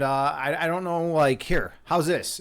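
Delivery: ordinary talk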